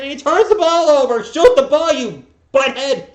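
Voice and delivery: furious high shout at the TV; frustrated yelling. Real, not acted. Fan yelling at a Spurs player on TV, furious shout. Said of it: "I'm just frustrated".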